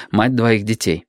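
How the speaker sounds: plain reading voice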